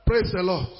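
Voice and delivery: normal talking voice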